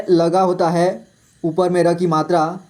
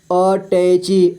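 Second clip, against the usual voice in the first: अटैची slowly as अ, टै, ची; syllable by syllable; much slower than usual. Shown as a demonstration. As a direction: draw out each syllable of attache. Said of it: "तो ट का क्या sound आएगा". (अटैची को तोड़कर: अ, टै, ची)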